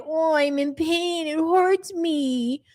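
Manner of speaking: mock her pained baby voice. Mocking how she talks to her family, slow baby voice. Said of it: "using that baby voice".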